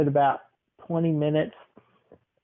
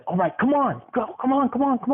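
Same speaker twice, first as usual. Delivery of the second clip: rising to a much higher, impatient hurry; pushy urging; 9 semitones higher than usual. Acted. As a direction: hustle her out the door. Stranger hurrying her out the door.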